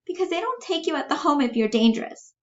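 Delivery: high, quick character voice; quick high-pitched defence; defensive Bella. Acted. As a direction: blurt it out high and fast. Reader voices Bella defending him.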